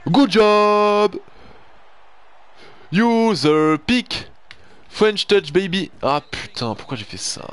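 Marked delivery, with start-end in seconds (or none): 0.1-1.1 high, loud self-congratulation; gleeful cheer. Real, not acted. Celebrating his own play. 2.9-5.9 high, loud, slowed announcer-style boast; hammed-up brag. Real, not acted. Bragging after his interception.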